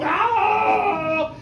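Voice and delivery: drawn-out dog wail; sick dog howl. Acted. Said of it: "he was a sick dog".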